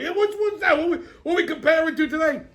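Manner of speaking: eager and giggly; high character voice, laughing. Acted. Character laughingly asks the host about the show.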